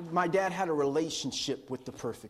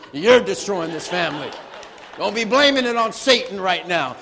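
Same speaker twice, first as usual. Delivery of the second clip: angry retort; 10 dB louder than usual. Retold or imitated. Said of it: "angry retort to dad".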